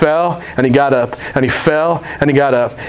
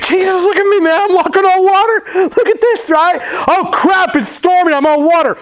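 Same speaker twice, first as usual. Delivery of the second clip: much higher, excited mock voice; excited Peter. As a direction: Peter thrilled, then panicking on the water. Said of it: "It's like".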